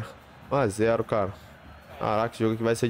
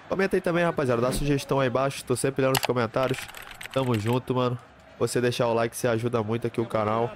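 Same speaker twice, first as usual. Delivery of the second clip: normal talking voice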